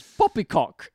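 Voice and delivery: hammed-up British accent; mock British accent. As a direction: punch it out as a mock Brit. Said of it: "doing British accents".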